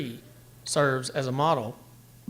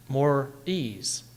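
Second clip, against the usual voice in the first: normal talking voice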